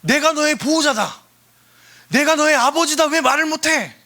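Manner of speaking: high, loud fatherly urging; God's voice. Acted. God urging his children to speak, high and loud. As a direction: call out like an insistent father. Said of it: "하나님은 끊임없이 우리한테 뭐라 그래".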